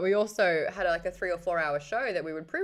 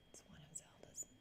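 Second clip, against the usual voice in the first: barely audible whisper; whispered aside; 26 dB quieter than usual. Real, not acted. Streamer repeating the hair remark to herself, whispered. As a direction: whisper it to yourself.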